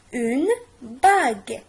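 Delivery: "bague" said slowly with a hard g; slow correct word. Shown as a demonstration. Teacher shows the right word to the class. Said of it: "On dit".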